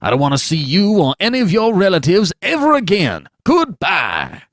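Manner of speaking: loud, high angry tirade; old doctor storms off at the father; cutting. Acted. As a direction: dress him down coldly. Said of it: "answered the doctor cuttingly".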